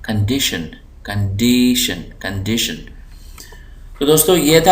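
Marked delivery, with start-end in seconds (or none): 0.0-2.7 condition with डी pressed and stretched in the middle take; stress on DI. Shown as a demonstration. Teacher demonstrating the stressed DI, heavy डी in condition. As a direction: punch the second syllable डी in condition. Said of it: "इसको हम ज़ोर दे के पढ़ रहे हैं".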